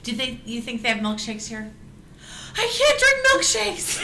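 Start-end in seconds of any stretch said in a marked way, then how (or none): 2.6-4.0 high, loud comic wail; dramatic wail. Acted. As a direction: wail it like a tragic toy. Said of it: "toy laments".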